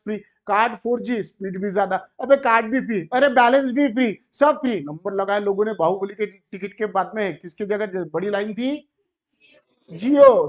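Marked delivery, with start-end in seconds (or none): 1.9-4.8 louder, higher exclamations piling up; hyped-up list of giveaways; excited amazement. Acted. Excitedly listing Jio freebies, mounting amazement. 9.9-10.5 loud drawn-out shout of the brand; big, punchy reveal; triumphant call-out. Shown as a demonstration. Shouting the answer to own question.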